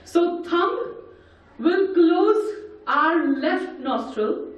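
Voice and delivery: ordinary talk, nothing marked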